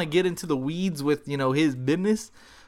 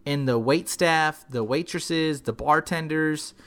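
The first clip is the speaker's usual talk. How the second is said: usual speaking voice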